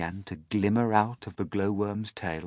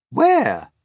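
high, loud, falling question; high clamouring shout; crowd asking; 13 semitones higher, 11 dB louder than usual. Acted. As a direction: demand it loudly as a crowd. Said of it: "they asked".

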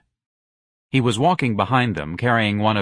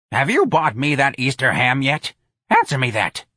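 put-on louder cranky old-lady demand; demanding old woman; 4 semitones higher, 3 dB louder than usual. A narrator's reading. Old clerk demanding her Easter ham.

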